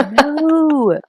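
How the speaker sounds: high, alarmed mock no. Mom stopping her son in front of classmates, alarmed. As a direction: cut him off in comic panic.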